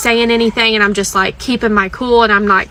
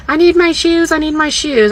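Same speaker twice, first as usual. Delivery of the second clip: mock whine, higher pitch; whiny daughter; 7 semitones higher than usual. Mom mimics daughter begging for her shoes, mild mock whine. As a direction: imitate a pleading teen trotting behind.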